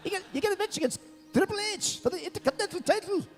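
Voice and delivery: high, fast mock Irish accent with a laugh; bad Irish accent. Imitating the Irish writer giving him the title match, bad Irish accent. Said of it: "I can't do an Irish accent".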